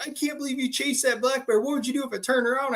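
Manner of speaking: high-pitched amazed rush; hyped up. Retold or imitated. Buddy questions the bear chaser, hyped up. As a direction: imitate a shaken friend in disbelief. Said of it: "all hyped up and shaking and stuff".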